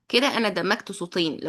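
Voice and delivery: ordinary delivery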